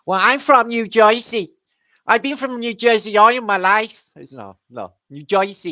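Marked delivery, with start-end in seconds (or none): 0.0-4.1 put-on high, slow, loud New Jersey accent. Doing a New Jersey accent for a friend from there, hammed-up. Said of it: "I can do a New Jersey accent". 5.1-5.7 New Jersey accent. Shown as a demonstration. Teaching a friend to say "New Jersey" the local way, mock accent. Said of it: "You gotta say it like that".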